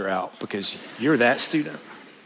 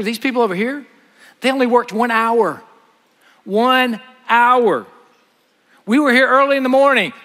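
higher, louder, aggrieved protest; indignant complaint; 8 semitones higher, 4 dB louder than usual. Acted. Early workers protesting their equal pay to the master, loud and aggrieved. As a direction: whine it out like workers cheated of pay.

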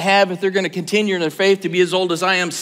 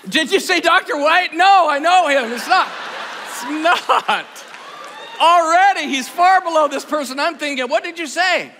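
playful teasing; 8 semitones higher than usual. Real, not acted. Bantering with audience answers.

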